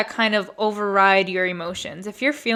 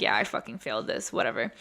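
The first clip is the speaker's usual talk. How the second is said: much softer, dropping low, defeated; resigned giving up; 7 semitones lower, 9 dB quieter, faster than usual. Retold or imitated. She gives up on the driving test, softly defeated. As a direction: mutter it like you've already failed. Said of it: "I was like".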